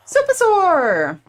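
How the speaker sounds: high, drawn-out cry of a name; excited greeting shout. Real, not acted. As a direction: hail an arriving friend, stretched out and high. Calling out arriving raider's name.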